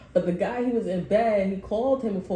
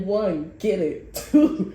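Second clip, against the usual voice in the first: hammed-up gym buddy counting reps; mock workout chant; mimicking the guy; 5 dB louder, slower than usual. Guy counting push-ups, mock.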